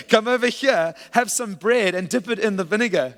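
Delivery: high, loud playful come-on; flirty invitation. Acted. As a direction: tease it like a suitor calling his date over. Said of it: "Boaz said to her".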